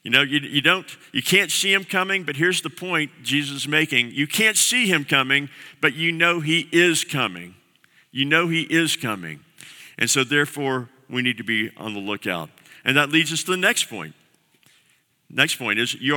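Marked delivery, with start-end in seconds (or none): none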